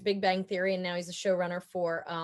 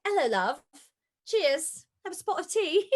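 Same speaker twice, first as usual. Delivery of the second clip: put-on higher-pitched, playful British accent; not-quite-proper British accent, pitched up; mock Cockney accent; 7 semitones higher, 4 dB louder, slower than usual. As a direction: put on a hammed-up Cockney greeting. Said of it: "I'm very Cockney right now or something".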